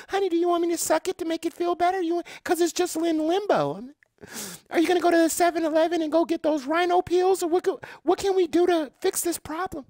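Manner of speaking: high, put-on woman's voice; mock girlfriend. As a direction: play the let-down girlfriend, high and breathy-free. Acted woman offers help.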